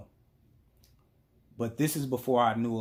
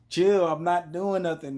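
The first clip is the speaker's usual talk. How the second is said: raised, louder retold denial; defensive protest; 7 semitones higher, 6 dB louder than usual. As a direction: protest your innocence to an accusing girlfriend. Defending himself in retold quarrel.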